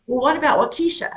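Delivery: retold suggestion, "Waukesha" as wah-KEE-sha; mispronounced Waukesha. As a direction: innocently stress KEE in "Waukesha". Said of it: "And I said".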